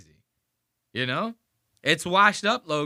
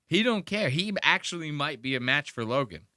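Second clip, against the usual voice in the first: ordinary talk, nothing marked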